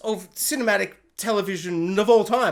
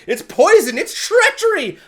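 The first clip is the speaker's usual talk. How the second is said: louder, higher hammed-up cry; dramatic accusation; 9 semitones higher, 6 dB louder than usual. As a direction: cry foul over the king. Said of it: "Daemon's still like".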